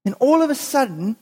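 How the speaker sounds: ordinary talk, nothing marked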